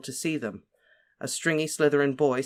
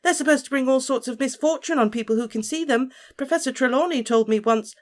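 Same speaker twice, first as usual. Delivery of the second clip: put-on much higher, fretful girl's voice; anxious Parvati; 11 semitones higher, 5 dB louder than usual. A narrator's reading. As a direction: fret like a superstitious schoolgirl.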